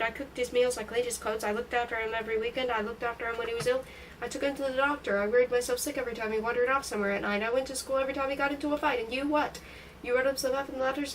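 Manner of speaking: ordinary delivery, nothing marked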